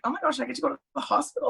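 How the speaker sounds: fast, giddy rush; excited. Retold or imitated. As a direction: blurt it out thrilled. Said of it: "I was like".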